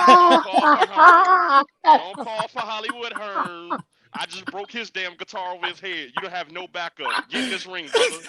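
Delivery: wrestling promo. Acted. Host challenging his co-host to the ring, loud wrestling promo. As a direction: call him into the ring like a pro wrestler.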